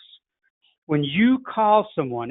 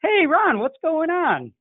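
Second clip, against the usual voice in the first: much higher, bright and eager hello; excited friend greeting; 12 semitones higher, slower than usual. Retold or imitated. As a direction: greet him like an old buddy you're thrilled to hear from. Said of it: "They say".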